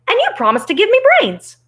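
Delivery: high, loud Scarecrow demand; insistent Scarecrow. Acted. Scarecrow reminds Oz of his promise, high and insistent. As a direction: claim it loudly, high-pitched, as the Scarecrow.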